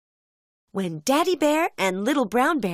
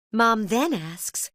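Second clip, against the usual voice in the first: plain reading voice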